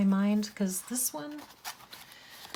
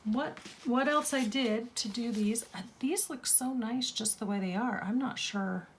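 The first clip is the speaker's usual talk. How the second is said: usual speaking voice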